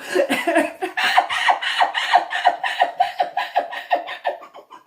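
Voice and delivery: long, high, loud breathless laughter. Real, not acted. Long high laughter at the whole scene.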